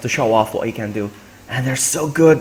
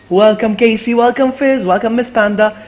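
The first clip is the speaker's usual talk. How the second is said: high, lively greeting; excited welcome; 9 semitones higher, 5 dB louder than usual. Real, not acted. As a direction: call out each welcome with real excitement. Greeting incoming raid viewers excitedly.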